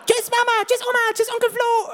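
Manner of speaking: put-on squeaky little kid saying goodbye; very high, childlike farewell; high child voice. Child voice in imagined ad.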